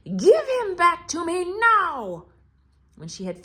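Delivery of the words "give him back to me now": high, slow, loud witch voice; a menacing witch claiming her prize. Acted. Witch demand ends.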